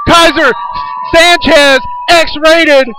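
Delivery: high, loud name calls; excited announcer. Acted. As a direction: call out each name. Naming the champions.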